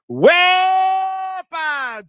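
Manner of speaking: long, high, loud whoop, stretched out; celebratory holler. Real, not acted. Streamer hailing a super chat donor, celebratory holler. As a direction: belt it out, holding the first syllable.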